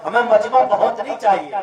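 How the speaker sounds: usual speaking voice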